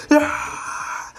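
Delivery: high, breathy mock cheer; strained high crowd yell; imitated crowd cheer. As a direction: cheer like a whole crowd, held back.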